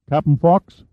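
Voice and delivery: put-on a nervous teenager answering; young sailor. A narrator's reading. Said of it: "The voice was unfamiliar".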